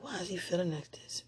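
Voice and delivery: hushed unvoiced whisper of the question; whispered inner thought. Wife recalling her puzzled thought at the funeral, whispered. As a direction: breathe it like a secret thought. I was like.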